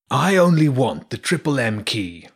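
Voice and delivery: lightly voiced menacing villain; a hint of a cold demand; villain Gralf. A narrator's reading.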